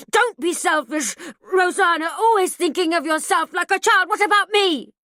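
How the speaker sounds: loud, high, frantic rage; raging. A narrator's reading. Mother in a mad rage at her daughter, furious. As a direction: lose all control. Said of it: "a mad stranger".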